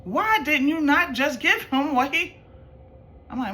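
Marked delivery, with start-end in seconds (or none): 0.0-2.4 mock motherly disbelief, pitched up and drawn out; slow, higher retold scolding in disbelief; imitating incredulous mother. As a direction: voice a mom who thinks you're crazy. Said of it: "looked at me like I was crazy".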